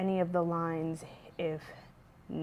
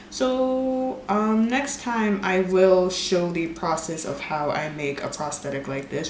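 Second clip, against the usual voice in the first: ordinary talk, nothing marked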